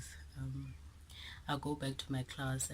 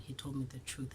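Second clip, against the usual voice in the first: usual speaking voice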